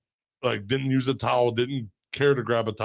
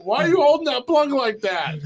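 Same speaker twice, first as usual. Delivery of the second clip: high laughing panicked yells; startled outburst; 5 dB louder, slower than usual. Retold or imitated. Retelling his scare to friends, high laughing panicked yell. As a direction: yell in mock terror, half laughing.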